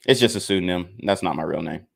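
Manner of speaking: ordinary delivery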